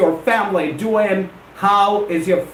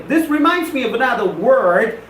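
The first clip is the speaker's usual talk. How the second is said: ordinary talk, nothing marked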